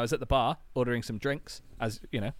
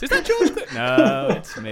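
high, loud self-admiring double-take; hammed-up mirror chat, laughing; mock vain man; 13 semitones higher, 7 dB louder than usual. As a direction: play him startled by his own reflection. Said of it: "looks in a mirror often and goes".

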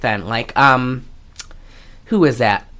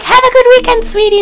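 very high, sing-out nurse voice; chirpy nurse; faster than usual. Retold or imitated. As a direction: chirp a sugary mock farewell. Retelling the nurse's cheery farewell.

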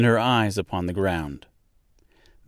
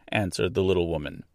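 usual speaking voice

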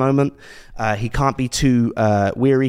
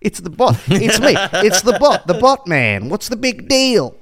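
high, loud, pitched-up bot man; pitched-up robot voice with a laugh; robot Bottas; 8 semitones higher, 3 dB louder than usual. Acted. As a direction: play a cocky robot, higher and louder. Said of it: "My name's Valtteri Botbot".

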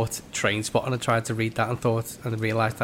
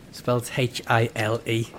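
ordinary delivery

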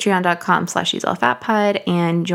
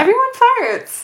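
silly high voice; 4 dB louder, slower than usual. Pretend. Joking defense of fart post.

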